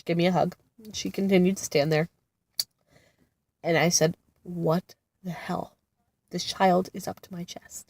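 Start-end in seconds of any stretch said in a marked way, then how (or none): 4.4-7.9 stunned, slow whisper. Retold or imitated. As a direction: hiss it out in shocked disbelief. Shocked aside about daughter's height.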